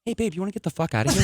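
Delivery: fast, sly come-on; sleazy pickup voice. Acted. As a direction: slur the line like a sleazeball. Acted pickup line, fast.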